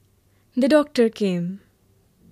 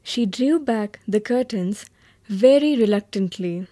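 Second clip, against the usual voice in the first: ordinary delivery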